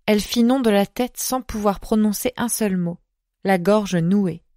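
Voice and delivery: usual speaking voice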